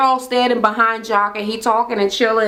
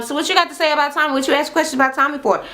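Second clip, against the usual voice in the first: fast, higher, pushy questions; rapid confrontation; 6 semitones higher, much faster than usual. Retold or imitated. Woman grilling a rival, fast and higher. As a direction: fire the questions off quickly.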